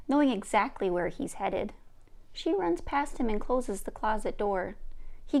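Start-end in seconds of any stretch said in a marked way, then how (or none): none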